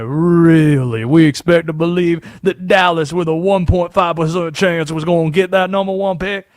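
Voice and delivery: a conspiracy sports host drawling his rigging theory; Southern Conspiracy Josh voice. Acted. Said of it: "Where did this accent come from?".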